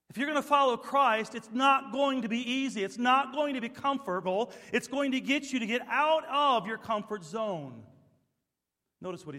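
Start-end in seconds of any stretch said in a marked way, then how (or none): 0.1-7.8 loud, high preacher's shout; fervent sermon shout; full-force preaching. Acted.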